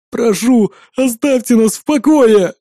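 high, slow whiny begging; whining plea. A narrator's reading. Tied-up twin begs his captors, whining. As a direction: beg pitifully to be left alone. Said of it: "ныл тот, что в одеялке".

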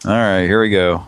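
usual speaking voice

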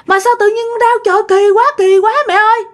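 high, whiny complaint of a sudden ache; complaining of pain. Retold or imitated. Mother telling grandma her belly hurts, whining high. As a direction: whimper it like a puzzled woman in pain.

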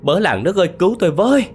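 high frightened wail, building to a shout; panicked stammering cry. A narrator's reading. Terrified man calls his parents and villagers about a ghost, panicked cry. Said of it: "miệng ú a ú ớ kêu lên".

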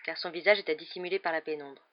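plain reading voice

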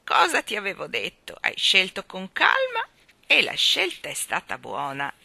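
put-on trembling old woman. Balloon woman chides the children, in a loud trembling old voice. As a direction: scold them like a frail old woman full of passion.

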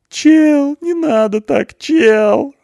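very high, loud tearful whine; mock sobbing plea. A narrator's reading. Punk begs not to be killed, high tearful plea. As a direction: plead as if in tears. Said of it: "рыдает Панк".

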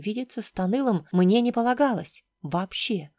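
plain reading voice

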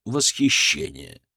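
usual speaking voice

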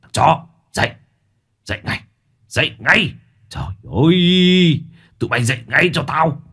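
furious yelling. Acted. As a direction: bark them awake through clenched teeth. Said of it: "Lão Tài nghiến răng nghiến lợi".

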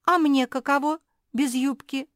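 high, loud, put-upon protest; loud complaint. A narrator's reading. Kuki in the bush with no skirt, protesting loudly. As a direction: cry out in indignant complaint.

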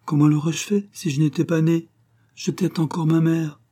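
put-on high, innocent child's reply; innocent lamb. A narrator's reading. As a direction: answer as a guileless little lamb. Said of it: "Geneviève répondit avec innocence".

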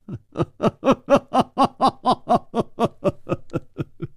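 long high laugh falling away; an old man's knowing laugh, let it roll down; laughs stroking his beard. A narrator's reading. Old master laughing at the young hero's praise, a long falling laugh. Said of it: "捋须而笑".

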